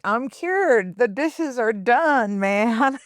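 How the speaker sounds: high, loud, drawn-out triumphant declaration; mock triumphant guy. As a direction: crow it like a guy off the hook. Mimics men declaring themselves cured.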